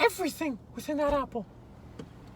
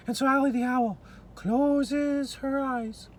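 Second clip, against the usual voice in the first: ordinary delivery, nothing marked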